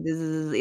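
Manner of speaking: weak motor zzz; sustained low mouth-made buzz; low buzz imitation. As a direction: imitate a toy with dying batteries. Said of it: "it was like a low".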